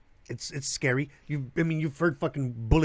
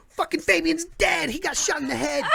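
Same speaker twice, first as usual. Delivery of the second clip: freaking out; 11 semitones higher than usual. Retold or imitated. Neighbors seeing him bleeding on the ground, imitating panic. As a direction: ham up the frightened neighbors a little. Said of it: "they're fucking freaking out".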